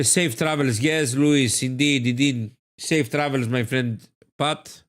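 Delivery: ordinary talk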